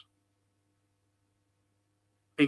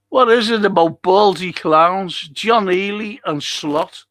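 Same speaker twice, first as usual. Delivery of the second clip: loud, raised jeering question to Steve; loud call-out; 4 semitones higher, 8 dB louder than usual. Real, not acted. Caller shouting to Steve about the clowns, loud and jeering. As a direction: belt it out, mocking the bald pair.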